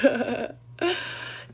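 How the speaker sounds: short burst of real laughter; amused laugh. Real, not acted. Laughs at friend's slip-up.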